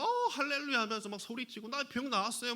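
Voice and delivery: high, joyful hallelujah cries; excited shouting. Pretend. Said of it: "막 이렇게 소리치면서 뛰어다녀야". (기뻐서 소리치듯)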